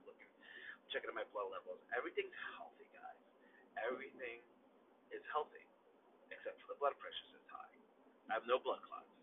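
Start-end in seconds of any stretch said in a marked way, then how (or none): 2.0-7.7 hushed. Real, not acted. Reporting results.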